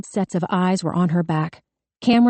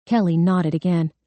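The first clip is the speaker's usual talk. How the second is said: ordinary delivery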